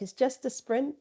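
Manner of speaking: soft, repeated reassurance to herself; quiet self-talk mantra. Retold or imitated. Runner calming herself before forty-six miles, quiet mantra. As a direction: murmur it to shrink the distance. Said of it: "in my mind to reduce it".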